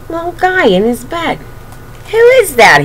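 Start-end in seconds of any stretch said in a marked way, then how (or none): 2.1-2.8 bear cub asking. Acted. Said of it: "he said".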